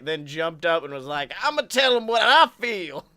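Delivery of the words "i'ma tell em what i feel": mock defiant declaration. Imagined banner smuggler jumps up declaring.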